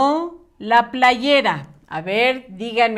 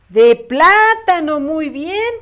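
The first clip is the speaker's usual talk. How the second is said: much louder, bright echo of the right answer; excited praise; 6 semitones higher, 7 dB louder, slower than usual. Real, not acted. Teacher confirming the pupils' answer, excited loud praise. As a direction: cheer the class answer out loud.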